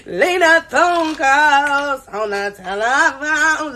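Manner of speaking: slow, hammed-up crying over the phone; mock crying. Retelling her heartbreak in a slow mock cry. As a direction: whine it out like a fake sob. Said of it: "So I'm crying".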